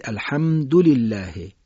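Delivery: الحمدلله held on ham, quick du joined to lillah; short joined vowel. Shown as a demonstration. Teacher modelling correct recitation, short joined vowel. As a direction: lean on the m, then dulillah together in الحمدلله. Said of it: "حرف قبلی که ساکن هست".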